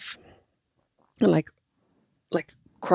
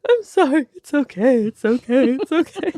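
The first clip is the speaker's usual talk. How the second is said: put-on high, teary voice hushing the baby; tearful soothing; 8 semitones higher than usual. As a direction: imitate yourself weeping while comforting. Soothing baby while weeping.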